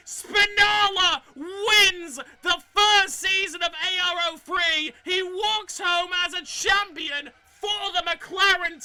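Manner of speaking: loud, high, ecstatic commentary yell; excited shouting. Real, not acted. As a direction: scream the winner across the line. Commentator calls race finish winner.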